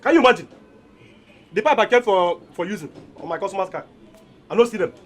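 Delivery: loud, upset shouting; angry complaint. Acted. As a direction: complain loudly and furiously. Angry complaint about stolen pipe.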